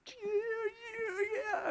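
put-on very high squealing child voice; high thrilled child cheer; excited kid. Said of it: "He's like".

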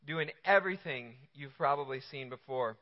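ordinary talk

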